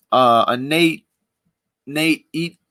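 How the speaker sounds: usual speaking voice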